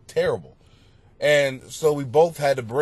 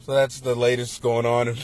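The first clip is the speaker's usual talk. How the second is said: normal talking voice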